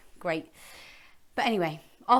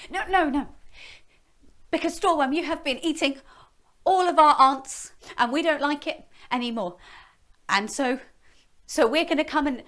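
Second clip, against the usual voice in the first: princess voice; 6 semitones higher, 4 dB louder than usual. Acted. Princess confronting dragon.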